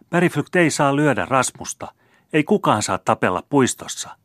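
high, loud scolding with a laugh underneath; a girl's cross but giggly high protest; half angry, half laughing. A narrator's reading. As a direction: tell him off while half amused. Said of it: "sanoi hän puoleksi vihassa, puoleksi nauraen".

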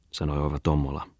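ordinary delivery

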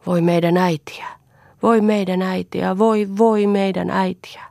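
whispered lament, voi stretched. Acted. Children lamenting their mother as they run. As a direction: grieve in a breathy whisper. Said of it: "myötään valittivat".

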